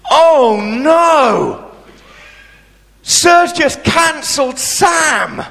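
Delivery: high, loud cry of dismay to the hall; dismayed announcement. Retold or imitated. Schoolgirl MC wails to the assembly, high and loud. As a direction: shout it like a girl aghast at the news. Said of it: "She walked out and went".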